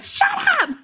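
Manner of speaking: very high teasing shut up; squeaky mock shut up. Mom hushing her son, squeaky mock shut up.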